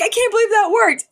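squeaky high delighted disbelief; giddy disbelief. Retold or imitated. Parents marvel their trick worked, squeaky and giddy. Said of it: "they were both like".

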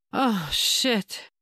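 mild breathless gasp, pitch rising; a touch of a gasp. Acted. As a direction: gasp it out lightly. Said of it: "she gasped".

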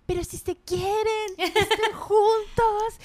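high, loud mock plea to the couple; exasperated pleading. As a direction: plead like a frustrated viewer, stretching the end. Acts out exasperated viewer pleading with couple.

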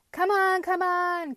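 high, loud rallying call. A narrator's reading. Pumpkin to the following vegetables, loud high call. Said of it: "called the pumpkin".

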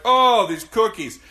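loud, high, drawn-out mock rapture; Phil Hartman. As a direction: gush slowly in a hammed-up movie imitation. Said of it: "fucking Phil Hartman and Jingle All the Way".

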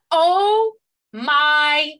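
loud, high, breathless "oh my God"; squealing with delight; thrilled gasp. Retold or imitated. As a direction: gush like a delighted fangirl. Gushing over a favourite trope.

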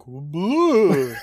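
lightly voiced, drawn-out spook; mock zombie boo. Fake zombie popping up on cue, mock spooky boo. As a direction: draw out a half-hearted zombie scare. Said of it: "like, a zombie".

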